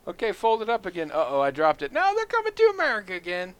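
high-pitched, hammed-up flag handler; mock alarm. Joking about a flag that summons immigrants, high mock alarm. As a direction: play panicky surprise in a high voice.

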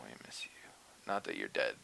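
hushed whisper. Real, not acted. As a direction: breathe it as a secret. Whispered aside.

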